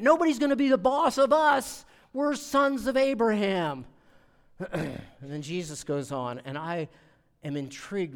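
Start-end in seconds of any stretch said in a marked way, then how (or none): 0.0-3.9 loud, high, proud declaration; defiant boast. Retold or imitated. Crowd boasting to Jesus, loud defiance. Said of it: "that's almost what they were saying".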